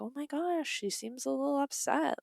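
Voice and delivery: high, soft mock concern; breathy high pearl-clutching; high, hushed mocking pity from interviewers; mock concerned gossip. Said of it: "people are like".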